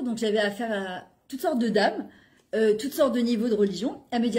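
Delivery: ordinary delivery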